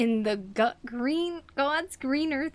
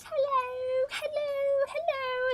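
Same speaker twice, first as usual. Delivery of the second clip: very high, held-pitch hello; piping, toy-like mock voice; imitating Teletubbies; slower than usual. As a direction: mimic a squeaky children's show greeting. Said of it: "They were like".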